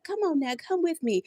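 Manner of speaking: ordinary delivery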